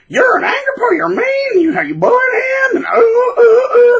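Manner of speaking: very high, loud crying accusation; mock shrill accusation. New partner shrieking at the old one, mocked.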